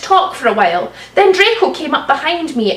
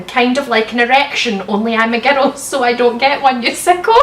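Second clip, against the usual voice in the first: normal talking voice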